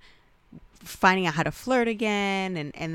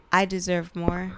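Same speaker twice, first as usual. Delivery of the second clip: normal talking voice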